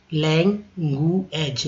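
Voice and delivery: लैंगुएज drawn out slowly as laing-gu-ej, every syllable clear; slow syllable split. Shown as a demonstration. Teacher shows the gu-ej sound in लैंगुएज, slowly. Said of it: "यू का उच्चारण ई यू के तरह".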